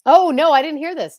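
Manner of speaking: loud, high burst of eager surprise; excited surprise. Real, not acted. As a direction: jump in, thrilled to hear gossip. Eager reaction to gossip.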